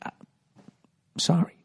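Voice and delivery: slow, high, hushed apology; mock cowering whisper. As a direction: apologize meekly, nearly all breath. Imitating Johnny Roast Beef apologizing.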